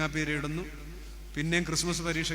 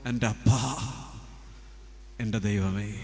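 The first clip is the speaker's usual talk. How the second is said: intimate prayer; 6 semitones lower than usual. Acted.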